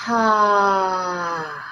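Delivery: long breathy sigh out; audible exhale. Shown as a demonstration. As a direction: let the breath out on a slow "ah". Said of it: "all that breath all the way down".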